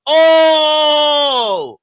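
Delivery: loud, high, sustained "ohhh"; drawn-out hollered "oh"; long "oh" shout. Real, not acted. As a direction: hold a big high "oh", then let it fall. Long exclamation over the figure.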